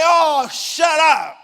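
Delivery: high, slow, drawn-out angry shout; heckler's shout. Retold or imitated. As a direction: drown the preacher out. Heckling the preacher, retold.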